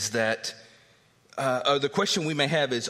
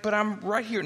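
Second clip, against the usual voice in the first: higher, pleading protest; mock protest; 11 semitones higher than usual. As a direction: complain you're already fine. Patient protests to doctor.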